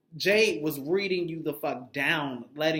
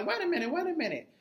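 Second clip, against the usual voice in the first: mock Chanel butting in; 8 semitones higher than usual. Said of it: "gonna say".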